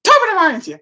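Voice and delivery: high, loud comic Irish catchphrase; typical Irish. Acted. Irish dog collar greeting on a sneeze, typical Irish. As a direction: burst out in stage Irish. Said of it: "says a very typical Irish, uh, catch".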